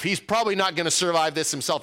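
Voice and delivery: ordinary talk, nothing marked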